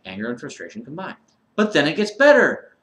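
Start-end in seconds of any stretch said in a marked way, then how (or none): 1.6-2.8 loud, high mock cheer; sarcastic. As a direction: announce it like bad news is good news. Sarcastic list of his ailments.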